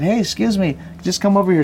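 high, bright call to a passer-by. Retold or imitated.

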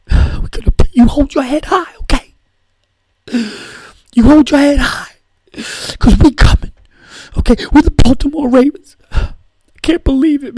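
mock victory speech pitched high and loud; Ray Lewis impression. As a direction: play a tearful, overexcited linebacker at a press conference. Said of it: "It's a, it's a little much, Ray".

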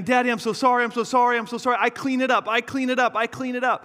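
high, pleading little girl's voice, imitated; mock a small child's tearful apology; sobbing little girl. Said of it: "she started sobbing".

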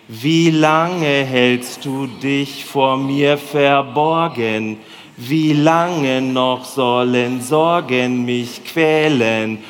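slow, measured churchy recitation; slow dutiful liturgy reading; flat liturgical reading. Shown as a demonstration. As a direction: read the psalm dutifully, slowly, restrained. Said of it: "lesen wir das einmal erstmal schwäbisch".

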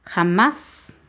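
"Jamás" stressed on MÁS, rising; stress on last syllable. Shown as a demonstration. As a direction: lean on the final á of "Jamás". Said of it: "se acentúa la A".

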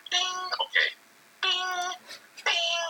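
text ding sound. Retold or imitated. Imitating text alert.

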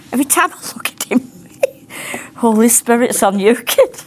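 high, laughing voice; giggling aside. Real, not acted. Preacher teasing someone in the room, giggling. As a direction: break up laughing mid-remark.

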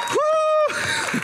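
high, bright whoop of a proud dad; cheering woo. Retold or imitated. Proud father cheering his children, high whoop. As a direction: whoop for the kids from the back.

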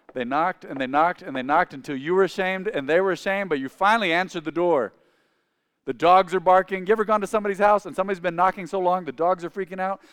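ordinary talk

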